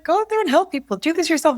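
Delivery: high-pitched coach's pep talk; insistent encouragement, pitched well up; imitated urging. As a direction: imitate a coach sending someone off. Retells coach urging her on.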